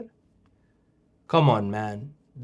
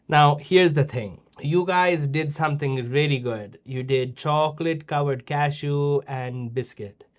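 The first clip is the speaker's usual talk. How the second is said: ordinary talk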